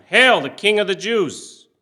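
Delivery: loud jeering salute to a false king; a soldier's loud sneering hail; mocking call. A narrator's reading. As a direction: call it out high and mocking, full voice. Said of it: "began to call out to him".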